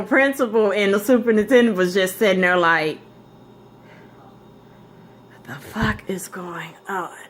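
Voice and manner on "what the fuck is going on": hushed whisper of disbelief; soft mock-stunned whisper. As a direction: whisper it like a shocked onlooker. Officials watching stunned, whispered.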